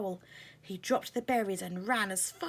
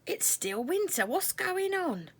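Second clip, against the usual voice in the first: puzzled; 11 semitones higher, 4 dB louder than usual. Acted. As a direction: wonder aloud, bewildered. Confused moth.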